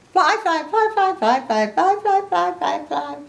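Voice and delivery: high, loud, rapid-fire chirping; Piggy. Acted. Piggy flaps her hands like wings, high excited chant. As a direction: repeat it excitedly in a high bouncy voice. Piggy bouncing along pretending to fly.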